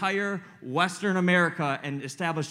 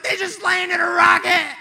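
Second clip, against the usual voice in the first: much higher, much louder joyous yelling; ecstatic shout; 12 semitones higher, 10 dB louder than usual. Retold or imitated. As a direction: yell the news at the startled pastor. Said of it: "I was like".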